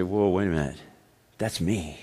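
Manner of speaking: soft, dropped-down aside; hushed realization. Retold or imitated. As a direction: quietly realize it's you. Listener recognizing themselves.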